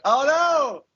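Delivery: high, drawn-out comic alarm; mock dismay. Podcaster acting out the dawning realisation, mock dismay. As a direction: wail it in playful dismay.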